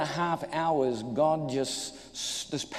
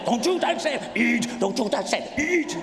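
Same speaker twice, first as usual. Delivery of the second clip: much higher, loud back-and-forth of two inner voices; brain and stomach battling; 9 semitones higher, 4 dB louder than usual. Retold or imitated. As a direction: flip between a scolding brain and a greedy stomach. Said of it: "This battle's going on".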